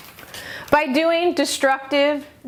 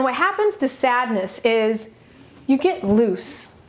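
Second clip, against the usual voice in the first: ordinary talk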